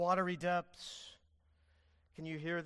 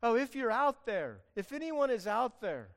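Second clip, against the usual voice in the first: raised, louder mock call into the void; hammed-up unsure prayer, higher and louder; vague searching call; 5 semitones higher, 8 dB louder than usual. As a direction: call out uncertainly to anyone listening. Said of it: "It's not a generic call like".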